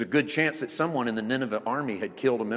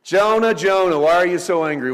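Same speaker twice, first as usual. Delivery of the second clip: loud, raised call to Jonah; God calling out; 5 semitones higher, 10 dB louder than usual. Acted. Preacher voicing God confronting sulking Jonah, loud calling out. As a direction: call out the name forcefully, easing slightly.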